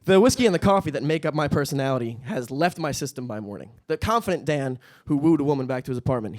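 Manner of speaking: usual speaking voice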